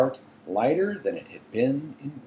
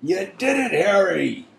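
hoarse, high, lightly voiced giant; choked-up hoarse praise; 10 semitones higher, 7 dB louder than usual. A narrator's reading. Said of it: "said Hagrid hoarsely".

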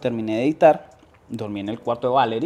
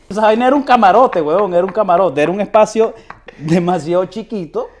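exasperated outburst; 7 semitones higher, 9 dB louder than usual. Real, not acted. Exasperated about tiny apartment.

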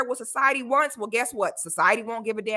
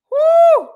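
much higher, loud whoo falling off; excited whoop; 12 dB louder than usual. Real, not acted. Vlogger whooping at the promo video, excited. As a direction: let out a big amazed whoop.

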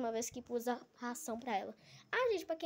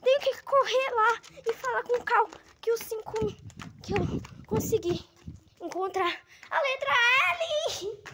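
high, loud rush of excitement; excited, breathless; 9 semitones higher, 4 dB louder than usual. Real, not acted. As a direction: gush it out, thrilled. Excited about the win.

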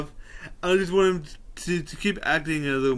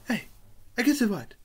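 ordinary talk, nothing marked

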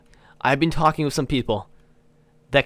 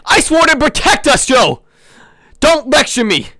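furious Sith yelling back, loud; angry shout; 6 semitones higher, 5 dB louder than usual. Acted. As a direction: shout him down in fury. Sith quarrels with Jedi Joe.

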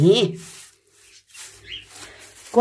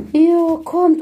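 high, louder call to the cats; 8 semitones higher, 6 dB louder than usual. Real, not acted. Calling her cats in from the heat, raised call. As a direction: call them in across the yard.

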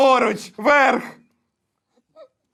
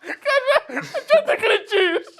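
mocking high voice; 11 semitones higher than usual. Teasing echo of the shouting complaint.

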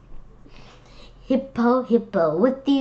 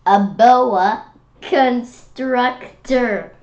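drawn out; 3 semitones higher, 8 dB louder, much slower than usual. Acted. Drawing out the snake's name.

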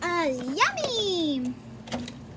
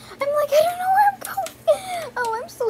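high, loud panicked outcry; flustered cry; 11 semitones higher, 6 dB louder than usual. Real, not acted. Kid loses control of the camera, high panicked cry.

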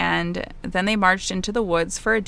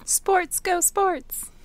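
high mock cheer, sports fan; 10 semitones higher, much slower than usual. Mocking the sports result.

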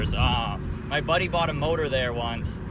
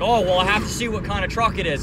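higher, louder mock irritation; retold anger; 5 semitones higher, 5 dB louder than usual. Old clerk mad at the customer, mock indignant. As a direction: imitate a clerk getting mad. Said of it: "he gets mad at me for that".

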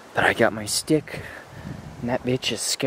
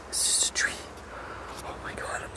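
hushed whisper rising in panic; whisper, voice jumping up on "I'm so scared"; 13 dB quieter, faster than usual. Acted.

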